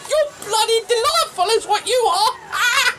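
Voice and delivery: laughing delight. Acted. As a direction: cackle with pure delight.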